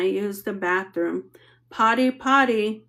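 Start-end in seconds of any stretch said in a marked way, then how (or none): 1.7-2.8 a hint of a little girl's chant; sweet little sing-song. A narrator's reading. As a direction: tease in a child's sing-song. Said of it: "Poppy sing-song in her sweet little voice".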